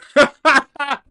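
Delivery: real high-pitched laugh; loud high laugh. Real, not acted. Laughing at his own joke.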